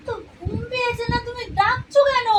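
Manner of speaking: high, loud, irritated at being woken; annoyed outburst. Acted. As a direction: snap back, fed up and sleepy. Wife woken at night by husband.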